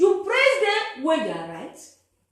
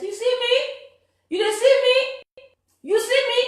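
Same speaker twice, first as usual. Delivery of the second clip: high, insistent question; pleading question; 10 semitones higher, 6 dB louder than usual. Real, not acted. Man in the dark asking viewers if they see him, high insistent question. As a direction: push the viewer to look at you.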